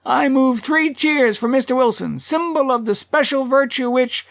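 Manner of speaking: high, rousing proposal of cheers; high-pitched character oratory; the Hatter proclaiming. A narrator's reading. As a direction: proclaim it high over a crowd. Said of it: "The Hatter".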